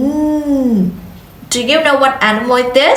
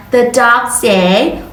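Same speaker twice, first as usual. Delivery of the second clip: ordinary delivery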